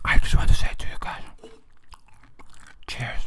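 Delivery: close-mic whisper. Real, not acted. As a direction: breathe the words right into the mic. Whispering while munching popcorn.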